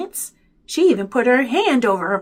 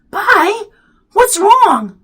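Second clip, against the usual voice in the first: high, loud concern; alarmed question; 12 semitones higher, 10 dB louder, much slower than usual. A narrator's reading. Boy asks crying brother what is wrong, alarmed. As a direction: call out in sudden worry.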